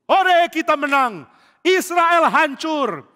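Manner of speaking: loud, slow victory cry; triumphant shout. Acted. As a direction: yell it like a winning army. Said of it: "Orang Filistin berseru". (sorakan kemenangan keras dan lambat)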